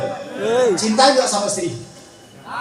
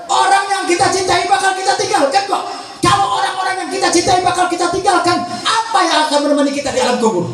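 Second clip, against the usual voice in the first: high, ringing sermon cadence with hammered questions; impassioned preaching; 7 semitones higher than usual. Acted. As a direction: preach insistently, stretching out the final phrase. Preaches at full force. (ceramah lantang berapi-api)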